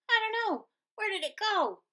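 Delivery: high, puzzled worry; alarmed. Acted. Prairie dogs missing the fuzz, high alarm. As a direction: sound anxious and lost.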